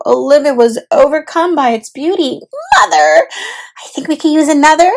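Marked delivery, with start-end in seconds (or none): 2.8-4.9 higher, quick, with a laugh; giggly request. Acted. Olivia asking for more gelato, giggling. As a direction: sweetly wheedle.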